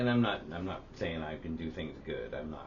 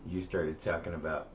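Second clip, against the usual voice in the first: usual speaking voice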